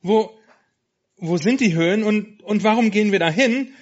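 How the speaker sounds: high, timid little lamb voice; hesitant. Acted. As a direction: ask haltingly, unsure and stammering. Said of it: "fragte sie zögerlich".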